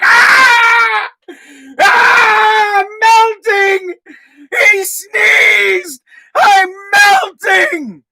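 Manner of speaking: high, loud, hammed-up wailing scream; mock pandemic panic. Imitating school staff panicking over a sneeze, hysterical screaming. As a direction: shriek it slowly in over-the-top hysteria. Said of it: "they're going into pandemic mode".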